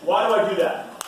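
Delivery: ordinary talk, nothing marked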